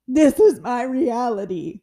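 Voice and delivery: loud, slow claim; mock-solemn insistence; mock defeated declaration. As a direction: announce it like it's final. Voicing the defeated mindset.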